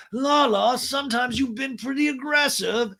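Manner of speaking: high-pitched mock scolding; hammed-up high reproach; Lisa. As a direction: imitate a woman protesting in a high voice. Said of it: "And Lisa's like".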